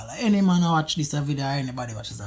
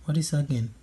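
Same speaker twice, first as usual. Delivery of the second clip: ordinary delivery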